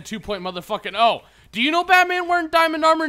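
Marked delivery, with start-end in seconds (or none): 1.5-3.1 boasting shout. Pretend. Boasting as Batman.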